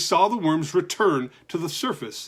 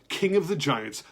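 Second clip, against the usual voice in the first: ordinary delivery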